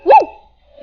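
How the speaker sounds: high loud whoop; victory whoop. Real, not acted. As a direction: let out a high triumphant yell. Winning the fight.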